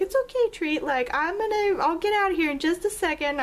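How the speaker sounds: high, soothing pet voice; reassuring the cat. Retold or imitated. Calling to the cat Treat from the shower, high and soothing. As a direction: coo comfort to the cat from the shower. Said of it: "I was talking at the cat from the shower".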